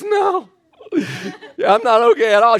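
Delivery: drawn-out, wobbly mock distress; imitated sick lady. Sick lady answers the preacher in the restaurant, mock distressed. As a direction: act out a woozy, hammed-up moan. Acting out altitude-sick lady's reply.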